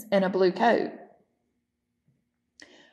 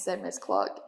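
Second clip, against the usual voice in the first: ordinary delivery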